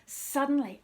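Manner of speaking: ordinary delivery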